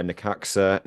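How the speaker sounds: ordinary delivery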